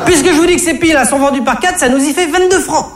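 voix de caissière très aiguë, exaspérée; vendeuse excédée. Acted. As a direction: rembarrer le client en glapissant. Vendeuse excédée qui insiste. (vendeuse glapissante et excédée)

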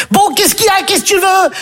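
high-pitched acted challenge; annoyed girl. Stéphanie turns on the boys following her, high and annoyed. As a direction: turn on them sharply, voice well up.

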